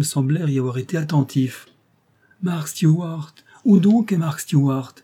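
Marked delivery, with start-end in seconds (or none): 2.4-4.9 higher, louder call for him; crowd calling. Acted. The crowd searches for Mark Stewart, calling out loudly. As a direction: call the name on every side. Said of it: "répéta-t-on de tous côtés".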